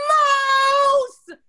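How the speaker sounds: very high, loud shriek; scream. A narrator's reading. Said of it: "Ronald screaming".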